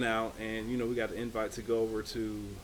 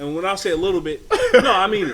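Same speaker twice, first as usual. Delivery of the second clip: laughing and louder; 8 semitones higher, 13 dB louder, faster than usual. Real, not acted. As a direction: laugh through the words. Joking about speeding a little.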